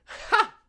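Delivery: short loud scoffing ha; sharp laugh. A narrator's reading. April scoffing at the bartender, sharp laugh. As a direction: bark one sharp laugh. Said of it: "April let out a sharp laugh".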